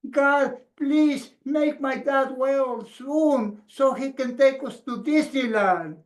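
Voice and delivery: put-on high, earnest little-boy prayer; child praying. Little boy praying for his dad, high childlike voice. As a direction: pray like a small child bargaining. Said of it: "And he says".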